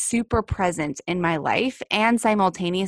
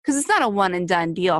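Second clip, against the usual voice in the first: ordinary delivery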